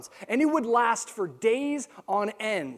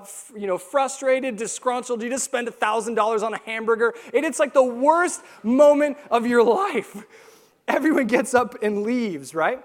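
ordinary talk, nothing marked

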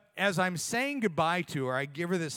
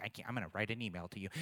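critical listener; 8 semitones lower, 9 dB quieter, much faster than usual. Retold or imitated. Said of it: "you're just critical in nature".

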